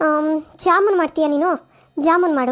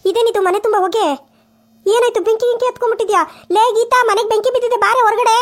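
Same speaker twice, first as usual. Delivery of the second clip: startled mother-in-law shouting; alarmed shout; 5 semitones higher than usual. Acted. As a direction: raise the alarm to the daughter-in-law. Mother-in-law sees smoke, alarm.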